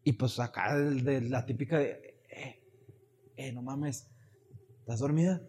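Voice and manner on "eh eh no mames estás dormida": hushed whisper. Retold or imitated. Waking cousin at night, retold.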